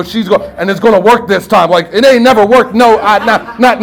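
ordinary delivery

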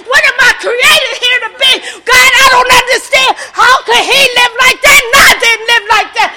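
loud, high testimony at full force; shouted testimony with laughter; preaching shout. Real, not acted. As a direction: preach it to the room.

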